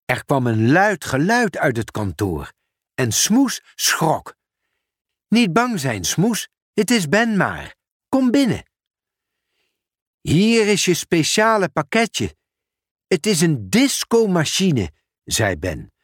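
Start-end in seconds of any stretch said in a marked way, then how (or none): 5.3-14.9 higher, eager colleague voice; a friendly raised voice with urgent news; Ben the sorter. Acted. Ben calls Pieter about an urgent parcel, higher and eager. Ben soothing, then presenting.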